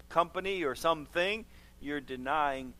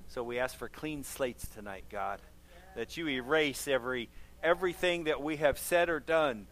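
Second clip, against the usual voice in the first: earnest prayer voice, softening toward amen; heartfelt church prayer, quieter at the close; closing prayer; 3 semitones lower, 3 dB quieter than usual. Acted. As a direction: pray over the congregation, fading gently at the end. Pastor leads closing prayer.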